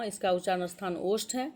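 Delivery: ordinary delivery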